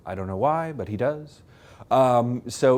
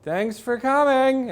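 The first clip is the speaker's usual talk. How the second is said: higher, slower, sing-song polite thanks; a cheery sendoff, imitated; high mock Carter farewell; 13 semitones higher, 4 dB louder, slower than usual. Carter calling after the fleeing guest, high and cheery. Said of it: "with Jimmy Carter going".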